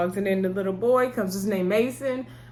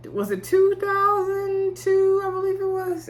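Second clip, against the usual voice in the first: drawn-out wondering; 12 semitones higher than usual. Real, not acted. Unsure what year, drawn out.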